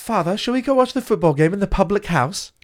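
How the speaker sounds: put-on high posh-boy voice; hammed-up posh son, pitched well up; mock posh accent. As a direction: ask father politely as a posh child. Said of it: "lose that fucking accent".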